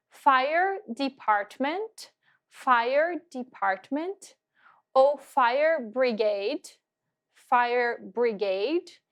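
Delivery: very slow English drill words; careful teacher drill; model pronunciation. Shown as a demonstration. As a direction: say each term slowly for repetition. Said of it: "vocabulary drill".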